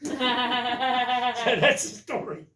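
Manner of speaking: slow, laughing wrap-up; chuckling through the end. Real, not acted. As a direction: laugh it out as you finish.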